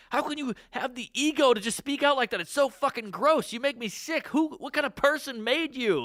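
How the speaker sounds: imitating angry rant. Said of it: "genuine anger about the heckling".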